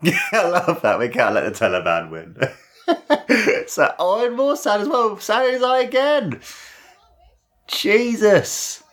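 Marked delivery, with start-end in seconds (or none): 3.7-8.8 high-pitched, amused exclamation; real high-voiced astonishment; excited disbelief. Real, not acted. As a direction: exclaim high as the chaos repeats. Reacting to more sand being thrown.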